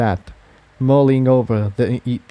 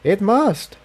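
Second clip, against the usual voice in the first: higher mare voice, earnest and a bit awed; higher filly voice telling what she saw; Tiptoe; 10 semitones higher than usual. A narrator's reading. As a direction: voice her higher, a young mare explaining. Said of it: "replied Tiptoe".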